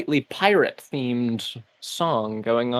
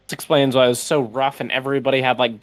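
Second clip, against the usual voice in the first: normal talking voice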